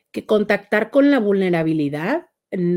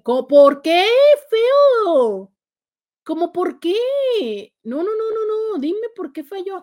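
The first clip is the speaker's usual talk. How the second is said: high, drawn-out incredulous questioning; mock-dramatic dismay; 9 semitones higher, 3 dB louder than usual. Host demands why a viewer's Valentine birthday failed, theatrically dismayed. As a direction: protest as if personally affronted.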